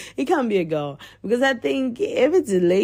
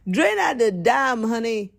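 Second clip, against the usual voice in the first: ordinary talk, nothing marked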